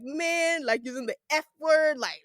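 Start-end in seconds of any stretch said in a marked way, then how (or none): 0.0-0.6 Hollywood slang. Retold or imitated. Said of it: "saying things like".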